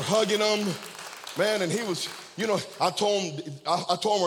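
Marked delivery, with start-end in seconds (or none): none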